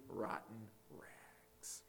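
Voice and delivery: disgusted slow whisper. Real, not acted. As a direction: whisper it slowly, almost no voice. Whispered image of sinful deeds.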